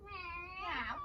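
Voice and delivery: high, soft mock meow for the kids; happy cat meow. Shown as a demonstration. Teacher showing the class the happy cat sound, playful high meow. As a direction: play a content cat, lifting the pitch. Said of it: "and what do we do?".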